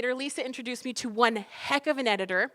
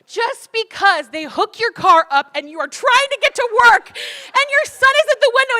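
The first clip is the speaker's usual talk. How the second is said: louder and higher, building with fervour; impassioned preaching; 10 semitones higher, 10 dB louder than usual. Real, not acted. Speaker preaching to the crowd about losing her car, fervent. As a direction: preach it with rising fire.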